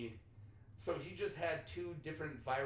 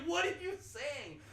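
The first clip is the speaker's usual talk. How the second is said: high, slow, exasperated question; mock bafflement; 12 semitones higher, slower than usual. Retelling his confusion at a Boston guy's words, exasperated. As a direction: stretch it out in hammed-up confusion.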